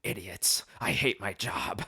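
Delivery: low, soft exasperated grumble; weary judge aside. Retold or imitated. Judge fed up with idiots in court, weary aside. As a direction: grumble under your breath, fed up. Judge muttering in retold scene.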